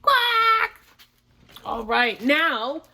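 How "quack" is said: high, loud single quack; duck quack. Acted. Reader quacks after title.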